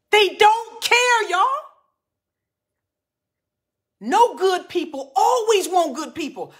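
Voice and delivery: high, loud, drawn-out warning; preacher's outcry. Real, not acted. Preacher warns wives about smooth-talking men, crying out loud. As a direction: cry it out to wake up the congregation.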